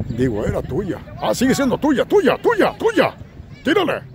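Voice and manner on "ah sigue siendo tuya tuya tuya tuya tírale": high-pitched urging from the sideline; frantic cheering commentary; excited play-by-play. Acted. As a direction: egg the player on, voice jumped way up.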